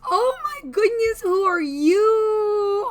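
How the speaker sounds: high squealing gush; overwhelmed by cuteness. Real, not acted. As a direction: squeal at something adorable. Overwhelmed by cute character.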